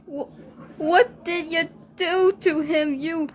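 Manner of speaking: high, loud, crying rage at the killer; anguished outburst. Acted. Rabbit screaming at the monster who ate Mickey, anguished. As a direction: scream your grief at him, voice breaking.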